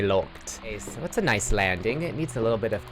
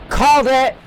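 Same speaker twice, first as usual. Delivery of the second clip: high, loud victory shout; triumphant shout; 9 dB louder than usual. Real, not acted. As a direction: shout it out, proud you were right.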